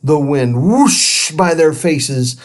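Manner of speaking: ordinary delivery, nothing marked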